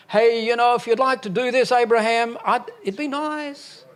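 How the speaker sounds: high, light, offhand pleading voice; mock casual request. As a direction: make God sound like he's just politely suggesting. Mocking God as a casual asker.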